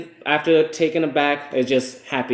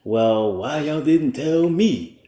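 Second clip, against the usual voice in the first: mock complaint; 3 semitones higher, much slower than usual. Husband voicing relatives who wonder why nobody told them, mock complaint. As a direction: imitate a put-out relative, dragging it out.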